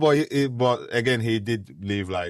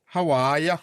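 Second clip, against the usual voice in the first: mock British accent, bright rising "ya"; British greeting; 6 semitones higher, 5 dB louder than usual. Host teasing co-host with a put-on British hello. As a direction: put on a cheeky British "How are ya?".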